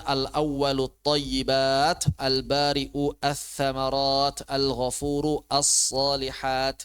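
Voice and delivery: slow, deliberate Arabic word list; measured example reading. Shown as a demonstration. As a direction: sound out each word slowly with long vowels. Reads Quran example words for learners.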